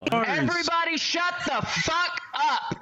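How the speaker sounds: high, slow, furious shout; angry yell. Acted. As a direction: bellow it at the whole room.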